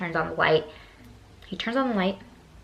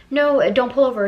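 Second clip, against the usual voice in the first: loud, raised retold objection; alarmed protest; 5 semitones higher, 8 dB louder than usual. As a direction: blurt it out to stop him.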